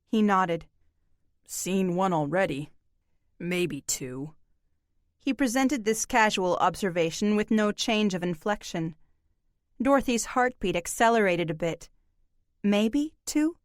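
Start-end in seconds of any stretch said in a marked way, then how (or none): 1.5-4.3 low, terse drifter; gruff stranger voice. Acted. Laconic stranger answers. 12.6-13.6 higher, rising alarmed echo; startled question. Acted. Alarmed girl echoes him.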